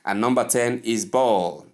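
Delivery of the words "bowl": "bowl" as one syllable, bohl; correct bowl. Shown as a demonstration. Teacher modelling word number ten, bowl in one syllable.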